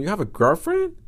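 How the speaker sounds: high, incredulous question; shocked. Retold or imitated. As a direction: ask it in retold disbelief, pitch up. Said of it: "I was shocked when he told me".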